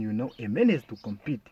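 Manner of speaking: ordinary talk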